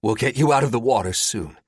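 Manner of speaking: gasping for air; winded reassurance; out of breath. A narrator's reading. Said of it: "between gasps of air".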